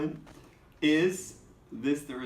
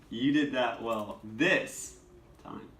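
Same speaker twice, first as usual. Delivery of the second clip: slow, "this" raised, pause before "time"; stress on "this"; slower than usual. Shown as a demonstration. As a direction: hit "this", then wait. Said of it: "different inflection on the words".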